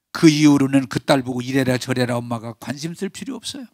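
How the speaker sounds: ordinary delivery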